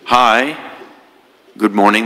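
ordinary talk, nothing marked

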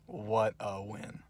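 quiet murmur. Real, not acted. Fan murmuring to himself after the win, soft and slow. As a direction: savour "What a win." under your breath. Repeated, dropped to near whisper.

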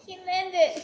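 loud happy call, falling at the end; excited cheer. Real, not acted. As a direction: cheer the landing loudly. Cheering a landing.

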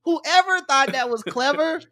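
loud, mock-angry challenge. Real, not acted. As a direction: call the culprit out, laughing. Angry rant about the movie.

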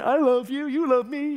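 put-on cheesy children's show host; Barney the dinosaur. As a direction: chirp it like a kids' TV dinosaur. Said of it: "He's a big purple dino".

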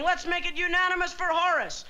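imitated yell. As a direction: quote an excited supporter calling out. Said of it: "started yelling".

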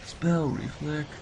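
ordinary talk, nothing marked

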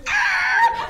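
very high, loud screeching car sound; squealing brake noise; imitated tire screech. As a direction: mimic the car skidding to a stop. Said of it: "eu achei que o carro ia fazer".

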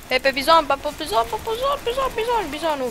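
rising high excited repeats; excited calling out. Real, not acted. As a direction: call out the gun name eagerly.

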